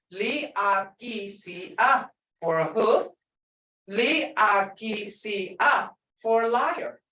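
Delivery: Li-a-ki-si-a spelled slowly, letter by letter. Shown as a demonstration. Teacher spells the word slowly for the students. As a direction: sound out Li-a-ki-si-a one letter at a time. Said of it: "look how it's spelled".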